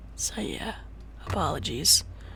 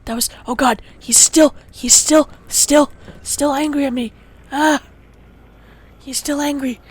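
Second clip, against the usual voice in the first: higher and louder in alarm; frantic; 3 semitones higher, 8 dB louder than usual. Real, not acted. Player alarmed the Enderman is still angry, frantic. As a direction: cry out that he's still angry.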